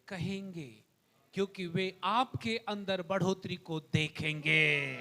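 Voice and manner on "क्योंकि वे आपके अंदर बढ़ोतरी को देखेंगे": normal talking voice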